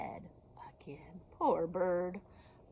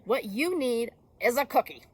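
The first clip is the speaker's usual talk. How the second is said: louder, sure-of-himself beaver; confident beaver; 3 semitones higher, 7 dB louder than usual. Acted. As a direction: hand out the answer boldly. Beaver's confident loud fix.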